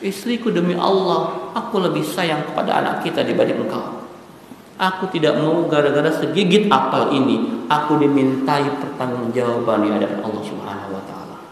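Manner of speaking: a ruler answering from deep conviction; solemn caliph. Retold or imitated. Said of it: "Apa kata Umar bin Abdul Aziz".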